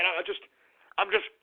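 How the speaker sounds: normal talking voice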